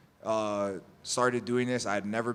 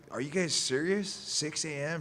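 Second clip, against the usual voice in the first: higher-pitched mock indignation; retold annoyed disbelief; 6 semitones higher than usual. Athlete confronting testers at the door, retold indignant disbelief. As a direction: challenge them, mildly exasperated. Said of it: "I'm pissed".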